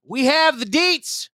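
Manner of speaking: high, hyped announcer voice; mock news anchor. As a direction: sell the scoop like breaking news.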